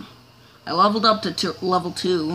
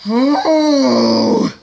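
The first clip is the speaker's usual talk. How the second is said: louder, higher cry of frustration; pained cry; 5 semitones higher, 9 dB louder than usual. Real, not acted. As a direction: cry out as it goes wrong. Crash in game.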